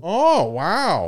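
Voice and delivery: high, loud Upper Midwest lilt; North Dakota accent. Pretend. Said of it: "North Dakota accent".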